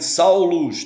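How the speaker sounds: "Saulus" said loudly with a falling end; emphatic Greek name. Shown as a demonstration. Teacher reads the Greek name from the text. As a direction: stress "Saulus" out loud.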